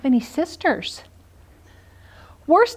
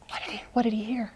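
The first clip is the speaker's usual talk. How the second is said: whisper; 11 dB quieter, much faster than usual. Acted. Fast whispered aside.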